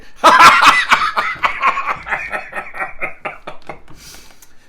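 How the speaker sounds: high, airy wheeze of laughter; gleeful wheezing cackle; breathless wheezing laugh. A narrator's reading. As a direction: laugh till you cry, out of breath. Chuckled till he cried.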